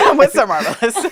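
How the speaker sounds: laughing through "I'm with Sir Marvelous"; giddy. Retold or imitated. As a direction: say it like a giddy new pet. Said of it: "I was giddy".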